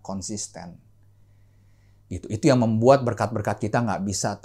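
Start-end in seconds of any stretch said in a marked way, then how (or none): none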